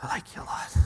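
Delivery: lightly voiced, embarrassed Peter trailing off to a whisper; quiet, downcast mumble that ends in a whisper; ashamed Peter. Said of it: "Kicks the ground".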